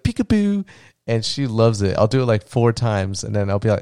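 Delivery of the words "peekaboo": high, sing-song peekaboo call; playful peekaboo. Retold or imitated. Dad calling peekaboo through the car window, high and playful. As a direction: sing it up high to make her laugh.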